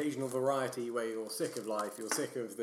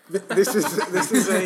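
laughing; 7 semitones higher, 13 dB louder than usual. Real, not acted. Laughing while reading the label.

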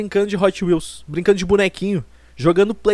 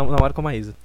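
ordinary delivery

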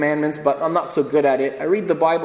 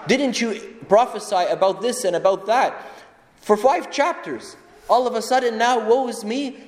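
incredulous challenge; 7 semitones higher than usual. Acted. Mock challenge to Isaiah.

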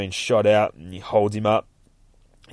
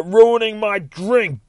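high, loud, drawn-out complaint; outraged shout; 12 semitones higher, 6 dB louder, slower than usual. Acted. Henchman swinging a chair at Nightwing, outraged shout. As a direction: yell it as you swing.

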